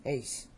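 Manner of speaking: "Ace" with a long ay vowel; classical Attic vowel. Shown as a demonstration. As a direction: say Ace to rhyme with face. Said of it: "the way it should be said".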